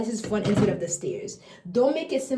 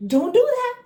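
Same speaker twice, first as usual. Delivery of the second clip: squeaky high copy, louder; mocking baby echo; 6 dB louder than usual. Baby echoing the parent's no, high squeaky mimic. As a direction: parrot the parent back in a tiny voice. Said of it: "They're like".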